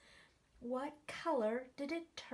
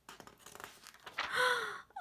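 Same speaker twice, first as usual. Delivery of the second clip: high, startled intake of breath; sharp gasp; 11 semitones higher than usual. Acted. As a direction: gasp in mock shock. Reacting to blueberries.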